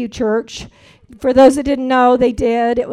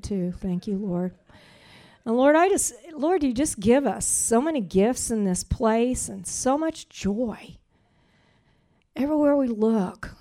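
prayer. Acted. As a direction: pray it gently, thanking God. Leads a prayer.

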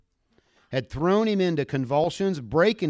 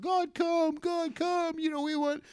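mock crying out; 13 semitones higher than usual. Crowds crying out to God.

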